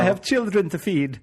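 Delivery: high Irish leprechaun voice, laughing. Retold or imitated. Joins the leprechaun bit.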